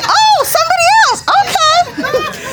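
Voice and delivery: high squeal of mock confusion; mock high exclamation. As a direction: pitch it way up like a flustered forgetter.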